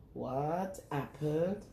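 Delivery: plain reading voice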